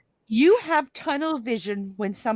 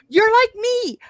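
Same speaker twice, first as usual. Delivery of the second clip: surprised recognition; 12 semitones higher, 7 dB louder than usual. Real, not acted. Sudden recognition of a kindred spirit.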